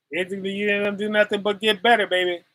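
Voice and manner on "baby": ordinary talk, nothing marked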